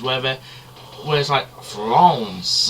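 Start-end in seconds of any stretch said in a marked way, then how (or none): 1.6-2.7 "France" with a nasal French vowel, cartoon style; cartoon French accent. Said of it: "that kind of thing you hear in the cartoons".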